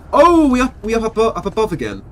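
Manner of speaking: high, loud burst of delight; thrilled to be up here; excited. Real, not acted. Surprised arrival up above.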